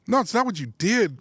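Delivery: high, emphatic correction; retold exasperation. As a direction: act out an exasperated spouse. Wife's retold exasperated reply.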